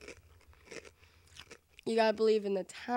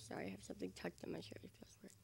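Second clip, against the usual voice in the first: whispering; 17 dB quieter, much faster than usual. Real, not acted. Aside while adjusting shirt.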